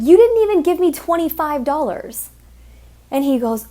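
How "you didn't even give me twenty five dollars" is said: louder, higher indignant comeback; indignant retort. Retold or imitated. Stripper retorting to a stingy customer, louder and indignant. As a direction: throw his stinginess back at him.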